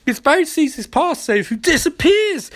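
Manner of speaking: ordinary delivery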